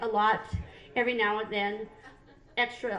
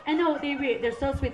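ordinary talk, nothing marked

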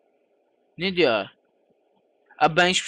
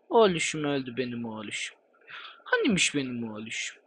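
lower, softer mock-mourning over the lost little son; mock tender lament; 5 semitones lower, 7 dB quieter than usual. Gamer mock-mourning his dead in-game son, soft lament. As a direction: coo over the dead kid in fake grief, quietly.

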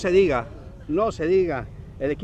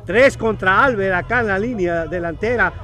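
excited play-by-play; 2 semitones higher, 8 dB louder than usual. Real, not acted. Live play call, rising.

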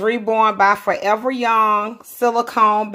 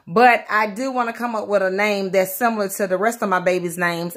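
usual speaking voice

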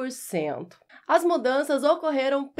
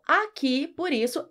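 usual speaking voice

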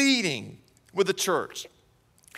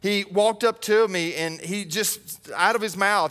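usual speaking voice

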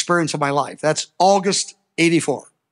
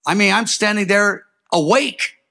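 usual speaking voice

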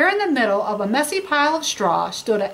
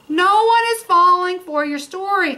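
high, louder mocking jab; mocking Humpty Dumpty; 8 semitones higher, 4 dB louder than usual. Humpty Dumpty calling out the wolf, mocking. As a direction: crack the line like a mocking egg. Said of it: "cracked Humpty Dumpty".

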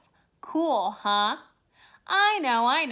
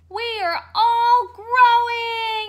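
high, loud joyful shout; bursting with excitement; excited cheer; 7 semitones higher, 6 dB louder than usual. Acted. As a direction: cheer that everyone is growing. Seeds cheering together.

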